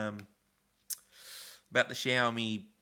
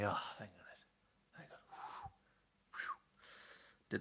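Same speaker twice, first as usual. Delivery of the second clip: relieved whisper; 4 semitones lower, 15 dB quieter, slower than usual. Real, not acted. Relief after worry.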